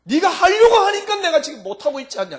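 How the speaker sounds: high, loud exasperated cry; Jesus pleading, high and loud. Acted. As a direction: burst out in frustration. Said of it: "이럴 겁니다, 아마".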